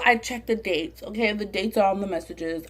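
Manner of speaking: ordinary talk, nothing marked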